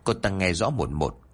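plain reading voice

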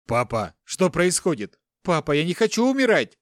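high, loud frightened plea; panicked cry. A narrator's reading. Captive son begs his father for life, panicked cry. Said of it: "закричал Руслан".

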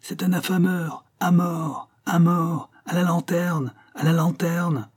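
high chanted mob cries; mock mob chant, pitched high; crowd shouting. A narrator's reading. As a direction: call for his death like an angry crowd. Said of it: "des voix qui criaient".

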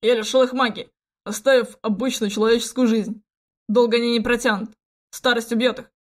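cold, menacing villain voice; spiteful. Acted. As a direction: gloat with malice. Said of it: "злобно произнёс принц".

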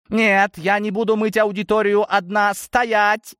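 high, indignant cry ending in a command; exclaiming. A narrator's reading. Gromova chasing the escaping Osokin, exclaiming. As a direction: cry out high as you give chase. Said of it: "воскликнула Громова".

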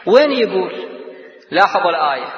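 ordinary talk, nothing marked

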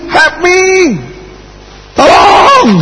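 high, pleading cry for help, rising to the top; desperate shout. Acted. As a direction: beg at the top of the voice as he is dragged away. (jeritan putus asa minta tolong)

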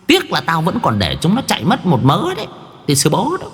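high, boastful goblin bragging; gleeful creature voice. Acted. Yêu tinh khoe với cậu, giọng yêu tinh cao hớn hở. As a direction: brag like a greedy spirit.

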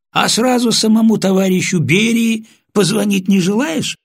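high, loud, sarcastic question; mocking. A narrator's reading. Sergeant to prisoner demanding a senior officer, mocking.

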